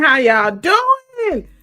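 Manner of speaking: drawn-out greeting. Real, not acted.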